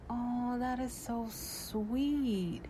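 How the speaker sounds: slow, drawn-out giddy coo; giddy sweet. Retold or imitated. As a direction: melt at the compliment, lightly. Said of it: "So I'm like all giddy".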